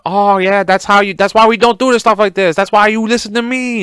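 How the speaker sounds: a hammed-up Korean dictator voice, smug and lecturing; a mock Korean accent, lecturing and pleased with himself; mocking Kim Jong. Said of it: "I'm making fun of Kim Jong".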